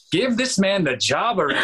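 high, playful mock demand; hammed-up cheering on; joking enthusiasm. Producers to returning auditioner who knew their names, joking enthusiasm.

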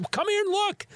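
put-on squeaky high kid calling his mom over; high child voice. Boy calling his mom to see the missing shoes, high voice. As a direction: pipe it up like a little boy.

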